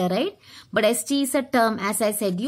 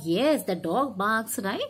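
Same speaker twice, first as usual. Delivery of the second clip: normal talking voice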